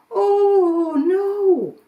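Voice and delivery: high, loud dismayed cry; alarmed oh no. Acted. Mummy Goat finds the door open, high alarmed cry. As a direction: gasp in dread.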